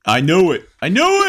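loud high mock shout. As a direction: call it out loud. Mock agreeing loudly.

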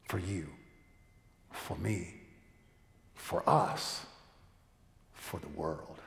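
low, hushed benediction with long pauses; solemn sermon closing. Acted. Preacher closing with good news for all, solemn and hushed. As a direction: let each short phrase settle, spoken low.

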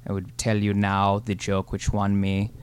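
lightly put-on German accent; proud German joke champion. German character Hans.

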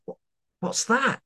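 high, alarmed "what's that?"; startled cashier. Retold or imitated. Cashier spots something odd in the wok, startled and high. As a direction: voice jumps up in shock at the wok. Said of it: "she was like".

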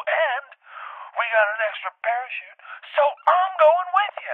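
very high, loud, slowed gloat; triumphant shout. Retold or imitated. Host telling the guest he's coming along, gloating shout. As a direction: twist the knife with glee.

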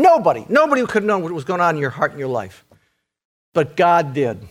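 ordinary delivery

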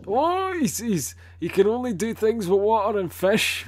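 high, loud sneering parody of the critics; mocking voice. Mocking people who belittle Aquaman.

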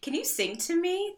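high, dreamy plea; lightly voiced smitten princess; mock swooning Rapunzel. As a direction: ask like a lovestruck princess.

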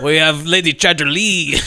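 slow, badly faked French accent; terrible put-on French; cartoon French accent. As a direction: show off like a cartoon French seducer. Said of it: "It's a cartoon Frenchman".

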